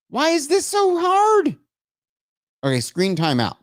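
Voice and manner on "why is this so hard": high, drawn-out exasperation; exasperated outburst. Real, not acted. Streamer fighting phone settings, exasperated. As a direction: wail it slowly in high-pitched frustration.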